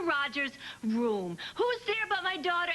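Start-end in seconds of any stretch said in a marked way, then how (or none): none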